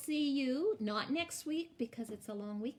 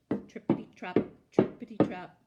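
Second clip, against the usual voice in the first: punchy loud hoof rhythm; loud trippity trap; 3 semitones lower, 9 dB louder than usual. Acted. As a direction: clatter the hoofbeats out. Hoof sound effect.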